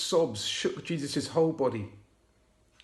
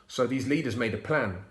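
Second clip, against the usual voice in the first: ordinary delivery, nothing marked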